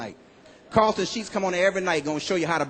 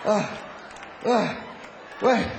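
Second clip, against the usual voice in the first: mock out of breath; 4 semitones higher, slower than usual. Acting out breathless person.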